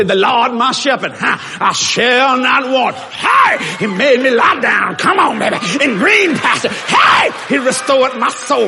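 loud, shouted whooping preacher cadence; preaching at full force. Shown as a demonstration. The pastor showing how he would preach Psalm 23, shouting and whooping. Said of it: "I would just say".

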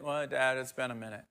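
soft, mumbled admission; sheepish son. Retold or imitated. Grown son confessing to his father, soft and sheepish. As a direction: own up quietly, a bit embarrassed.

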